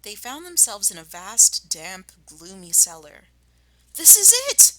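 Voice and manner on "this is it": much higher, louder triumphant cry; excited cry. Acted. Mister Fox discovering the cellar, crying out. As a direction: burst out in triumph. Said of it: "cried Mister Fox".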